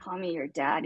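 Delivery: breathy low whisper. Retold or imitated. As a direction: whisper it like a seduction. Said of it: "It'll be like".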